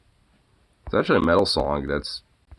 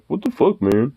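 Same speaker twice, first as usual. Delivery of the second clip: much higher, indignant dog voice; voicing the dog; 8 semitones higher, 3 dB louder than usual. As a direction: give the dog's offended look a voice. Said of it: "gives me this look of, like".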